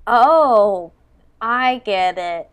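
loud, slow dawning realization. Real, not acted. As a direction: blurt it as it clicks. Suddenly understands the quiz.

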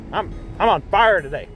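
ordinary delivery

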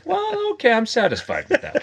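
a high, pleased little voice; mock-satisfied audience in a squeaky high voice.

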